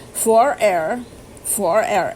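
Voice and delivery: testing out "for air" for hvor; Norwegian pronunciation attempt. Shown as a demonstration. Learner reading phrasebook aloud, trying out "for air". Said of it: "you pronounce it vor".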